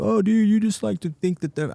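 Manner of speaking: high-pitched mock voice of naysayers; mimicking doubters. Imitates people doubting his optimism.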